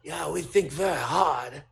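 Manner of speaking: put-on deliberate, halting Japanese accent with pauses. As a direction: imitate Japanese colleagues reporting their careful guess. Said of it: "here's my Japanese accent".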